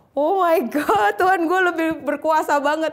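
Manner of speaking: swept up in awe; awed exclamation. Retold or imitated. Awed realisation of God's power.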